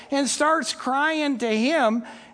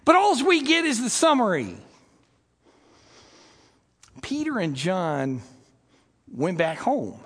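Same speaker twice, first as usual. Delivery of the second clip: usual speaking voice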